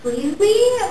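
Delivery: high, shocked "excuse me"; indignant excuse me. Retold or imitated. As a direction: throw it back at him in disbelief. Indignant at the guy.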